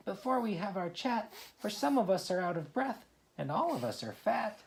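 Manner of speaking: high, small oyster voices; little oysters. Acted. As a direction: squeak it like tiny breathless oysters. Oysters' voice in poem.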